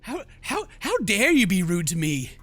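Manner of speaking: ordinary talk, nothing marked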